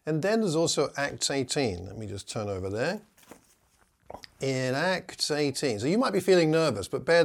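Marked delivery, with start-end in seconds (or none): none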